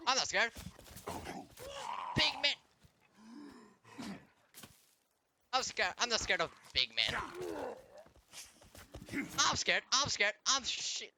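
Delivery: sarcastic fake fright; mock scared taunt. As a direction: taunt him like you're pretending to be terrified. Taunting enemy player, mock fear.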